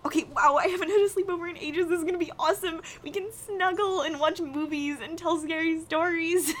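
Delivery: high, excited, gushing. Acted. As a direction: bubble over with excitement. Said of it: "Someone's excited".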